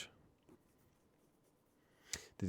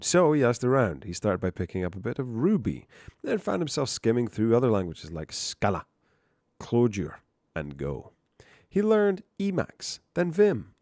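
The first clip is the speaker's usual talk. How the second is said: hammed-up storyteller with punched names; theatrical article read; 2 semitones higher, 7 dB louder than usual. Reads article aloud.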